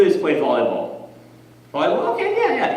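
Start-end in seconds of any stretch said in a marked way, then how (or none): 1.7-2.8 high-pitched, light voice; acted casual agreement. Acts reaction to volleyball.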